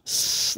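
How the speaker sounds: drawn-out hissing S; sustained "sss" noise; harsh sibilant. Shown as a demonstration. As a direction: demonstrate a long sibilant hiss. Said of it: "like, sibilant sound".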